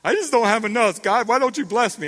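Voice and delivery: hammed-up spoiled complaint; mock complaining. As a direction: whine it loudly, playing the ungrateful believer. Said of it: "I'm complaining about these".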